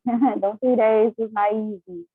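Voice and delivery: slow, laughing through the words. Real, not acted. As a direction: chuckle it out, slowly. Laughing about a hard fast.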